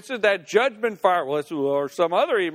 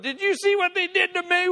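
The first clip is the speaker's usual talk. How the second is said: high-pitched wounded protest; mock aggrieved complaint; 11 semitones higher than usual. Hag quotes the self-pity morsel fed to grudge, high aggrieved complaint.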